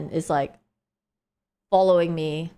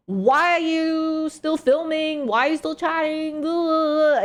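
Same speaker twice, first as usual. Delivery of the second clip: mock angry rant; 9 semitones higher than usual. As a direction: imitate his rant, stretched and high-pitched. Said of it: "he started getting really angry".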